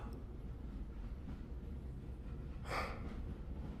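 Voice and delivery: long weary sigh. Acted. Weary detective alone thinking.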